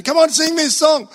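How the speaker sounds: high, loud upbeat worship leader; rally the congregation like a song leader. Retold or imitated. Imitating the song leader urging the crowd to raise hands, high and loud. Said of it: "the song leader says".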